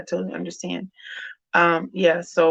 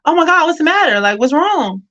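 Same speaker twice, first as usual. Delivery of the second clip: higher and louder, rushing in alarmed; alarmed mother; 10 semitones higher, 6 dB louder than usual. Retold or imitated. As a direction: burst in worried, voice jumping up. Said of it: "and then I'll come in and I'm like".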